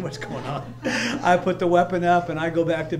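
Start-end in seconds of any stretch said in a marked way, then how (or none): 0.0-0.7 laughing. Real, not acted. Laughing at the false alarm.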